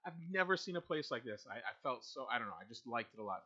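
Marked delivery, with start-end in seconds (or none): 0.1-1.5 retold amazement. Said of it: "And I'm like".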